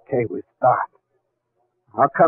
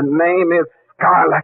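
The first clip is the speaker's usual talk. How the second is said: sharp correction; 7 semitones higher, 4 dB louder than usual. Acted. Gangster corrects his name sharply.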